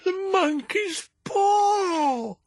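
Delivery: high, crying shriek, slow on the second; wild cry. A narrator's reading. Wife waking husband at night, wild high crying shriek. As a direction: wake him in a frantic cry. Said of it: "a sudden wild cry from his wife".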